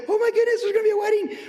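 high, giddy squeal of wedding joy; happy crowd. Retold or imitated. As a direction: gush like a thrilled townsperson. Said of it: "They're all happy".

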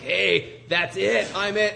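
loud, high imitation of a man seizing power; excited proclamation. Newly anointed king rises to claim the throne, excited. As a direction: call it out, voice jumping up.

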